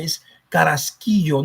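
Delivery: Carrasquillo said the Spanish way, kah-rahs-KEE-yo; correct Spanish pronunciation. Shown as a demonstration. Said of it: "when you say his last name".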